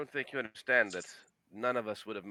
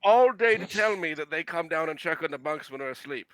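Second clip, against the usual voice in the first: higher, louder, frustrated outburst; exasperated protest; 6 semitones higher, 12 dB louder than usual. Acted. Player snapping at the NPC who never mentioned the guards, exasperated. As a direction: throw the complaint back, loud and fed up.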